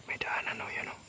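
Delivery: hushed, very soft whisper; real barely voiced whisper; stealthy whisper. Real, not acted. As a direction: whisper a discovery to your companion. Quietly pointing out a path.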